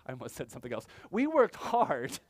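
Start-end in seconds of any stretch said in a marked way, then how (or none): 1.1-2.2 high, loud and slow; indignant protest. Retold or imitated. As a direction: stretch it out, aggrieved. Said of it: "They're like".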